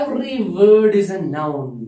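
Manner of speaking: each word drawn out slowly; slow rule to remember. Shown as a demonstration. Teacher to students, a slow rule to remember. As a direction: spell out the rule slowly for the class. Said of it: "याद रखना।".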